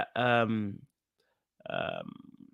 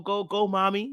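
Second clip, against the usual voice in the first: high-pitched cheer, not shouted; root for your partner; mock fan chant, pitched up; 6 semitones higher than usual. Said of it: "scream from the hilltops".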